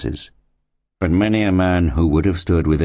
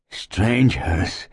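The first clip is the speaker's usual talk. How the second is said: higher, drawn-out wondering cry without volume; sick man's exclamation; 6 semitones higher than usual. A narrator's reading. Ailing settler sees newcomers, exclaiming as best he can. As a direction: exclaim with little breath to spare. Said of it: "he exclaimed, as soon as he could speak".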